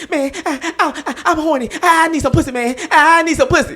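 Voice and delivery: high whiny needy friend voice; imitating horny friend. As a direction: whine like a desperate buddy. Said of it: "He said".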